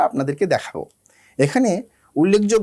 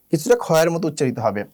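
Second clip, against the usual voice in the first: ordinary talk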